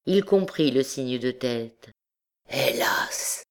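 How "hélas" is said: a breathed, unvoiced alas; hesitant sigh. A narrator's reading. Hunchback hesitating before confessing, breathed alas. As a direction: trail into it, unsure. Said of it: "dit-il comme hésitant à achever".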